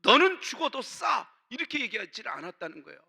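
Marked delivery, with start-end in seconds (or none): 0.0-1.3 mock rebuke. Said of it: "책망하면서".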